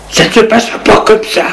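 fast, high angry threat; furious. Acted. Maurice furious at the doctor, fast high threat. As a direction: spit out the threat quickly. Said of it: "Maurice, furieux".

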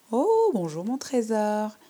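put-on high, slow, syrupy old-lady greeting; sugary old lady. Old lady greets the visiting girl, high and sugary. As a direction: coo it like a sweet old granny.

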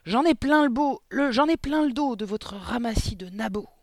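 high, loud furious roar. A narrator's reading. Headmistress roaring at the class, loud high fury. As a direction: explode with contempt. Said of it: "rugit mademoiselle Le Gourdin".

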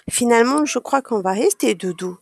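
plain reading voice

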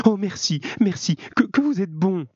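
high, breathless-with-relief thanks; overwhelmed woman's high-pitched outburst; grateful exclamation. A narrator's reading. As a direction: cry out thanks, stumbling with gratitude. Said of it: "Elle s'écria".

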